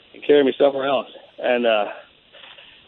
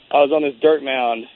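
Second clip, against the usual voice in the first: ordinary talk, nothing marked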